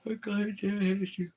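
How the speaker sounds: tiny high falsetto, drawn out; cooing pet talk. Real, not acted. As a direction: coo it slowly, way up high. Cooing to his dog.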